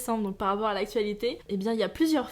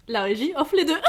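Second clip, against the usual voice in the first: en riant; 4 semitones higher, 6 dB louder than usual. Real, not acted. Plaisante sur les cadeaux.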